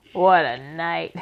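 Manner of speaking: slow, loud husky rat voice. A narrator's reading. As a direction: drag it out huskily. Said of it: "he said in a husky voice".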